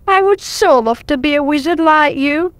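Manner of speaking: high, pleading little dragon voice, mildly tearful; lightly voiced weepy dragon pleading; crying. A narrator's reading. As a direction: beg the wizard with a touch of tears.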